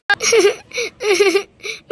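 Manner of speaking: put-on a loud wailing sob, a child's sob; crying out loud like a little girl. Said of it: "आप क्यों रो रही हैं".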